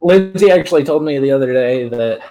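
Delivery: ordinary talk, nothing marked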